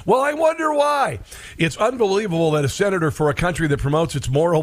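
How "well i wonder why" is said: sarcastic. Real, not acted. Sarcastic aside after quote.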